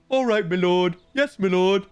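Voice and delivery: lightly voiced dim servant; a hint of a dopey guard; dumb guard voice. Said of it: "I draw that voice from the Age of Empire dumb guards".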